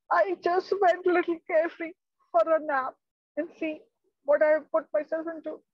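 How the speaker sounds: high, quick sheepish explanation; rueful father. Acted. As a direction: rattle off your excuse.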